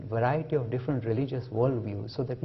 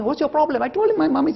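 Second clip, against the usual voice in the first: put-on excited boy; 12 semitones higher, 7 dB louder than usual. Wise man asks, boy answers.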